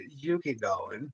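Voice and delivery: a hammed-up New York purr, slowed right down. Shown as a demonstration. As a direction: drag the name out, soft and camp, like a New Yorker. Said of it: "I did it in my gayest New York voice I could".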